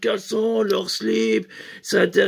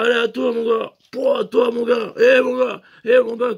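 higher, louder rowdy chant of mon gars; egging on; 3 semitones higher, 5 dB louder than usual. Retold or imitated. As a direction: hype each other up like drunk friends. Two friends egging each other on.

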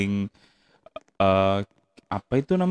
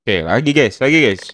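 usual speaking voice

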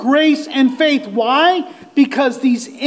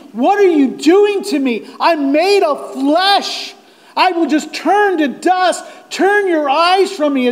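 higher, pleading cry to God; anguished plea; 5 semitones higher than usual. Acted. Sufferer like David and Job pleads with God, anguished. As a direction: plead upward, at the end of your strength. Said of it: "he's looking at God and he, like David, is saying".

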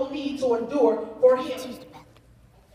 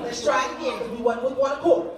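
ordinary talk, nothing marked

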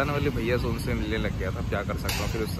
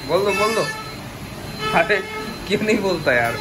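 higher-pitched joking nudge to speak up; playful teasing; 6 semitones higher than usual. Pretend. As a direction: tease a shy shop helper into saying hello. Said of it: "मजाक कर रहा हूँ". (मज़ाकिया छेड़छाड़)

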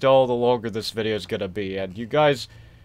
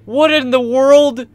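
slow, loud, high disbelief; startled outcry; 12 semitones higher, 8 dB louder, much slower than usual. Real, not acted. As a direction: cry out in shock, drawing the words out. Reacts to frightening tape.

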